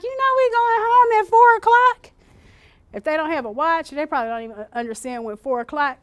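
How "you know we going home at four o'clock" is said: high, loud soothing caregiver voice; calm them with a promise; sing-song reassurance. Shown as a demonstration. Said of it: "and you're like".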